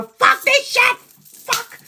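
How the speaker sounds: screamed high and loud, full release; angry outburst. Shown as a demonstration. Showing viewers how to vent, furious shout. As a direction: let the rage out in a scream. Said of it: "It is better to be angry and go".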